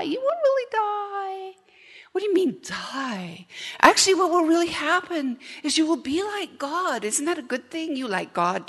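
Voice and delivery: high, coaxing tempter voice; Satan sweet-talking Eve into eating; sly serpent tempting. Pretend. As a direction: coax her like a smooth liar. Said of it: "he whispers half-truths".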